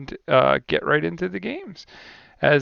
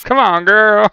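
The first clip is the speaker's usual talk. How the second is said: put-on high, loud playful "Come on, girl"; hammed-up exasperation; mock exasperated cry; 8 semitones higher, 10 dB louder, slower than usual. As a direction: throw it out like a teasing gamer groan. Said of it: "It could be a".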